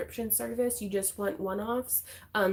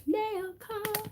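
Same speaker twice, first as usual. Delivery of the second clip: sing-song announcement; 12 semitones higher than usual. Real, not acted. Announcing the mail segment.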